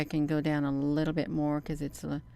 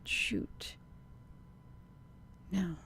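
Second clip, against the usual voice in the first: whispered curse; 10 dB quieter than usual. Real, not acted. Annoyed aside.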